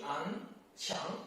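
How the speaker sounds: "an" and "qiang" rising from low; second tone. Shown as a demonstration. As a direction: lift "qiang" like a question. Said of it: "second tone".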